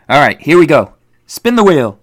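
high, loud hype-up; hyped giveaway announcement. Acted. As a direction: pump up the wheel spin. Spinning the giveaway wheel.

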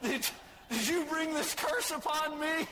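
high-pitched mock-indignant dad; outraged dad. Dad confronting his child over the GPS accent, mock outrage. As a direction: accuse with hammed-up horror. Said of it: "he looked at me and like".

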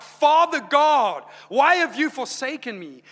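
loud, high anguished cry. Acted. Preacher voices Jesus on the cross, loud anguished cry. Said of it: "crying out".

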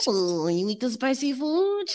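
high-pitched mock French accent; [name]'s French accent. Facialist quizzing her client on diet, high French accent. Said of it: "This is my French accent".

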